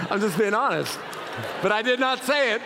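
laughing through the words; real chuckle while confessing. Real, not acted. Pastor laughing to congregation about the ticket.

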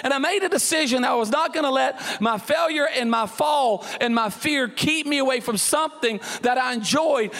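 ordinary talk

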